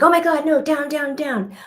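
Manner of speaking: high, urgent call that fades out; hammed-up alarmed dog owner; mock alarmed owner. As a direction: imitate a panicked owner calling the dog off.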